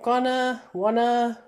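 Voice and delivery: tossed-off presenter slang; colloquial presenter words. Shown as a demonstration. Teacher demos slang words, loud and casual. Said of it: "we've got".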